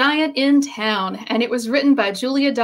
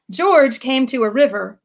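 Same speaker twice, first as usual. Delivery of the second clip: plain reading voice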